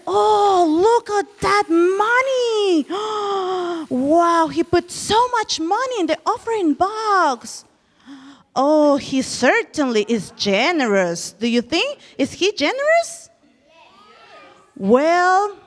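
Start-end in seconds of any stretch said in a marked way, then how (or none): 0.1-11.3 mock admiring whisper. Onlookers admiring the rich man's offering, mock awed exclaiming. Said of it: "people will whisper".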